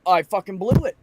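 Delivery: loud angry outburst; real anger. Real, not acted. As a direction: spit it out, fed up and loud. Angry about blowing up at work.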